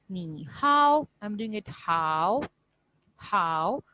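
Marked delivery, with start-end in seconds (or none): 0.1-1.0 "नी हाओ" with हाओ held long and pitched high; stretched हाओ. Shown as a demonstration. Teacher shows where she stretches, stretched हाओ. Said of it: "तो मैं हाओ पे थोड़ा सा". 1.7-3.8 "how" drawn out, falling low then rising; dipping third tone. Shown as a demonstration. Teacher isolates the stretched syllable, dipping tone. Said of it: "पहले आवाज़ नीचे करनी है".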